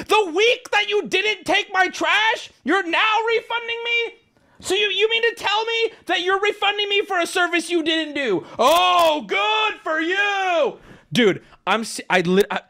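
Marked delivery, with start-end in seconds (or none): 0.1-10.8 high-pitched outraged yelling, building. Retold or imitated. Customer mocking woman's refund offer, yelling in outrage. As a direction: climax in a long, sarcastic shout. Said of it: "I literally was yelling at her".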